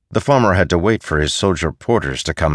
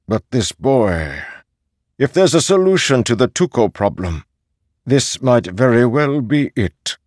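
raised, emphatic elder's voice; elderly Posen, forceful; 7 semitones higher, 4 dB louder than usual. Acted. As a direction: make the case as a senior master. Posen talks of his acolytes.

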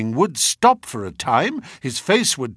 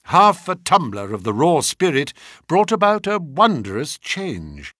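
usual speaking voice

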